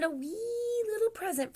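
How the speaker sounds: high, stretched wee little; tiny-sized words. A narrator's reading. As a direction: squeeze the pitch up for smallness. Narrator shows tiny size.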